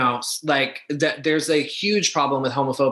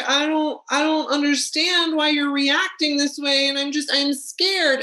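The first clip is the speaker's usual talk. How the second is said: small, scared fragile act; 10 semitones higher than usual. Retold or imitated. Mocking a woman who shrinks before an authority figure, small scared voice. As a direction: mimic a fragile victim in a tiny high-pitched voice. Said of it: "she gets really small".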